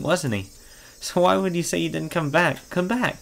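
put-on light, high questioning girl. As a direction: voice Rena, puzzled and probing. Player voices Rena.

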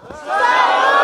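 loud, excited cheer; excited shout. Real, not acted. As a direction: shout it to the crowd. Hyping the audience.

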